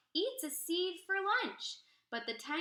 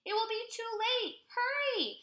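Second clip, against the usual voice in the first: rising, hurried, much higher urging; urgent; 10 semitones higher, faster than usual. Acted. Teacher urging the tiny seed on, excited. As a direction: hurry the seed along, higher and faster.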